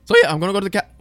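cracking voice mid-sentence. Real, not acted. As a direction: voice cracks up high. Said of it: "wow, voice crack central".

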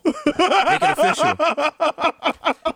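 slow, high, drawn-out announcer voice; mock announcer. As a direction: stretch it out like a hammy announcer. Hammed announcer line.

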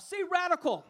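rally the congregation; call to the crowd. Real, not acted. Preacher calling on the crowd to repeat the word, loud. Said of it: "I wanna talk to you tonight".

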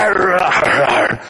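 long, rough growl of a straining dog; imitated dog growl. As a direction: growl like a dog tugging at a doorknob. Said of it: "growling and twisting".

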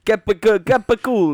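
high, loud mock-Italian chant; exaggerated Italian. Friend mocking sisters' food words, exaggerated Italian. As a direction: punch it like a cartoon Italian. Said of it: "more Italian than the other person".

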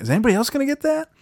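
high, fast incredulous squeak; retold disbelief. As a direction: pitch it way up in disbelief. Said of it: "I was like".

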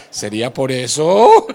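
laugh, then slow teasing question; laughing joke. Real, not acted. Preacher joking about being called a pig, laughing. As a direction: chuckle at your own belly joke.

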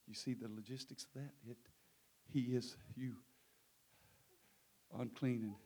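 very soft, low and halting; hushed, solemn preaching. Real, not acted. Preacher pondering the sin laid on Christ, low and hushed. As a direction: let each phrase fall into a long silence.

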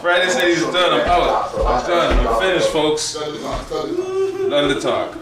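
loud, high mock quit announcement; hammed-up walkout shout to the audience; mock exit shout. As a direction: yell it like storming off set. Mock quitting, yelling out.

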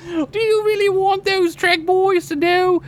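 slow, high-pitched attempted Aussie; Australian accent. Podcaster voicing an upset fiancée, mock Australian accent. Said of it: "try to do an Australian accent".

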